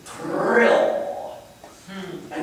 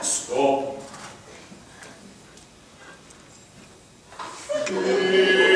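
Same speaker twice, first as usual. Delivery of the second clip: mock collapse. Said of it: "A small collapse, a little death".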